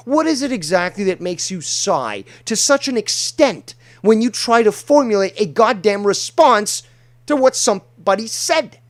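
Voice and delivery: loud exasperated rant; rising indignant yelling; angry rant. Real, not acted. Ranting at exasperating sighs.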